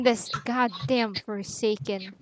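slow annoyed whisper. Real, not acted. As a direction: hiss it under your breath. Annoyed after walk-in.

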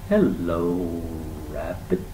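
drawn-out tortoise greeting with a sigh; unhurried, sighing animal voice; slow sigh. A narrator's reading. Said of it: "said the animal incredibly slowly with a long sigh".

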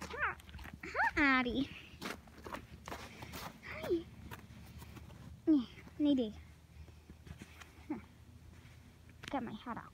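normal talking voice

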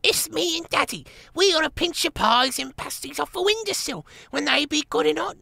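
put-on a giggling toddler blurting; baby mouse. A narrator's reading. Baby Gonflet tells on his father, very high.

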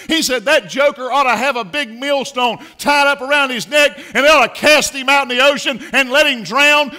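high, loud, hammering pulpit shout; preacher thundering the millstone warning; shouted preaching. Real, not acted. Quoting Jesus, then paraphrasing loudly.

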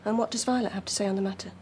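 brisk, low-pitched question; quick question. Acted. Mother questioning daughter about her boyfriend, quick and brisk. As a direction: ask it quickly in one run.

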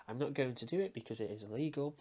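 ordinary talk, nothing marked

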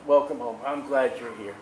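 low, soft welcome from God; tender voice of God. Acted. God welcoming a dying saint home, low and soft. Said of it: "God says".

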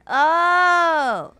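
long, loud "oh"; exclamation of sudden realization; loud realization cry. Real, not acted. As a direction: draw out a loud "oh" as it dawns. Realizes wife was kidnapped.

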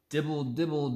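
ordinary delivery

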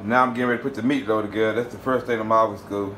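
ordinary delivery

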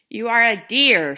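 loud storybook shout; a loud, playful deer yelling. Acted. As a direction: shout it back at Morris. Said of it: "shouted the deer".

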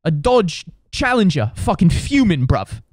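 high-pitched British grumble, punching the key word; indignant British bloke complaining to his mate; hammed-up British outrage; fuming British dude. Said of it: "He just kept saying".

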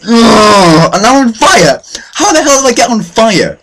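high-pitched cry of disbelief; exasperated yell. Real, not acted. Gamer suddenly on fire, high exasperated yelling. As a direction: wail loudly in exasperated alarm.

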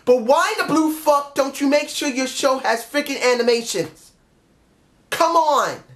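high, worked-up pleading rant; exasperated plea. Acted. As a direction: plead upward at the promoter in exasperation. Imagined plea to Booker T.

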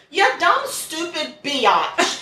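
high, drawn-out mock scorn; slow, high scornful jab; mock insult. Real, not acted. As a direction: sneer it out slowly with a pitch jump. Insulting the defendant.

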